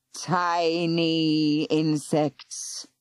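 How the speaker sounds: very slow, vast serpent voice; ancient serpent. Acted. Serpent addressing the tiny humans, slow and vast. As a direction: speak like an old god. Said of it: "Morgauris spoke".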